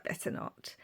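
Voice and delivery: a voiceless whispered refusal; whispered to herself. Retold or imitated. Tempted in the shoe shop, whispering to herself.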